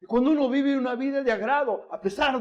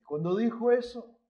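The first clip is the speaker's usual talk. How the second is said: ordinary talk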